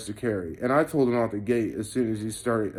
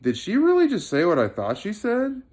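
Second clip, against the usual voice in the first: high, incredulous retold question; disbelief; 9 semitones higher than usual. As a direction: ask it as if you can't believe your ears.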